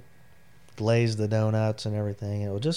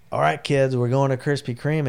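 put-on higher, louder rallying call to the kids; upbeat announcement; 5 semitones higher, 7 dB louder than usual. Dad announcing the donut trip to his kids, upbeat and loud. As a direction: round up the kids with gusto.

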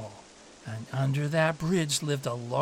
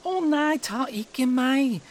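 pleading little goat; 10 semitones higher, 5 dB louder than usual. A narrator's reading. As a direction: beg the troll in a tiny goat's voice.

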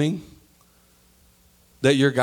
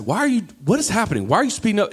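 high-pitched, rushed driver's complaint; exasperated; 9 semitones higher, faster than usual. Acted. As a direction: act out exasperation at a maddening driver. Exasperated reaction to a bad driver.

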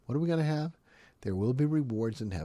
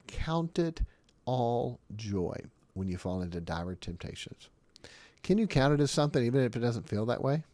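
normal talking voice

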